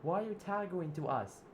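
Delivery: high, loud indignant demand; outraged protest. Acted. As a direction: push back hard at the attacker. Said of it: "And Zip said".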